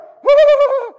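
high, loud mock cry; hammed-up awestruck howl; overwhelmed wail. Rider hearing how weak he was, high overwhelmed wail.

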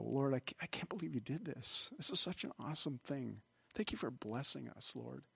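soft, intimate thanks to God; hushed, as if praying alone; retold private prayer. Pastor alone after service thanking the Lord, in hushed wonder.

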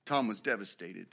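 low, hushed sermon illustration; somber storytelling. A narrator's reading. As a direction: tell it softly and gravely, with long pauses. Solemn story of a man's ruin.

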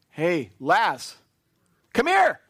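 mock-commanding shout into the tomb, rising high; calling out. Jesus calling his dead friend out of the tomb, big high shout. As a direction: call Lazarus out loud and high.

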